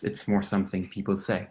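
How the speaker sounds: ordinary talk, nothing marked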